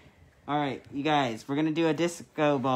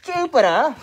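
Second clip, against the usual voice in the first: high, loud mock-British introduction; hammed-up British accent, pitched up; attempted British accent; 11 semitones higher, 12 dB louder than usual. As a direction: announce the cat in over-the-top British. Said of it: "that accent you're doing".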